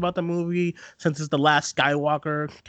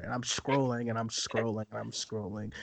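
each repeat softer, trailing away; fading repeated scrolling; 5 semitones lower, 10 dB quieter than usual. Retold or imitated. Friend retelling Twitter scrolling, fading quieter each time. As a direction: let it fade like endless scrolling.

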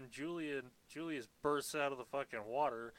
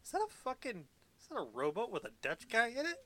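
high, fast mock dockworker disbelief; pitched-up New Yorker doing a double take; incredulous New Yorker; 9 semitones higher than usual. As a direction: gawk at the boat like a harbor local. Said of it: "Nobody in all of New York Harbor is like".

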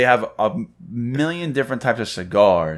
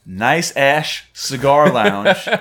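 slow announcement; 4 semitones higher, 4 dB louder, much slower than usual. Acted. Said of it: "announcing the lounge name".

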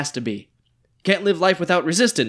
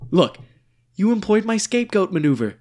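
ordinary talk

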